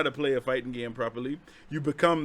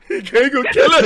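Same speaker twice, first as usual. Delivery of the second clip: high, loud, laughing fake panic; mock panic; 11 semitones higher, 10 dB louder than usual. Telling a story about a scary car ride, mock terrified squeal. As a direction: squeal it like you're about to be stabbed.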